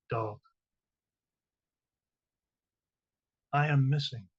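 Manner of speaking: measured, deliberate poetry recital with long pauses; reading a short poem to an audience, spaced and solemn. Acted. Reads her poem aloud.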